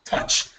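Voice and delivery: touch with a short clipped u; touch demo. Shown as a demonstration. Teacher models touch for the student, short u. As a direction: say touch as tuhch, no O U glide. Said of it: "you're saying too much O U".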